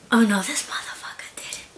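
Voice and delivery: breathless whispered disbelief; shocked whisper. Retold or imitated. Whispering to herself after the pillow hit, stunned. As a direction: hush it out, stunned.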